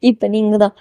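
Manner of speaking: ordinary delivery, nothing marked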